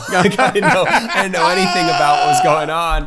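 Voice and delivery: real laugh at his own naivety. Real, not acted.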